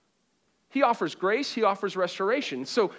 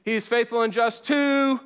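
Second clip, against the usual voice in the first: louder, higher, with conviction; like you mean it; 4 semitones higher, 5 dB louder than usual. Shown as a demonstration. Preacher urging the room to say it with conviction. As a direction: call it out and mean it. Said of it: "say it like you mean it".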